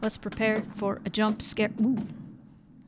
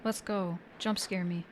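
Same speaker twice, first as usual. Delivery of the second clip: much softer, nervous bravado; quietly bracing for a jump scare; scared horror game streamer; 8 dB quieter than usual. Real, not acted. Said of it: "I'm scared".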